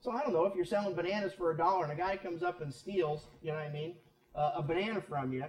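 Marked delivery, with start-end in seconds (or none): none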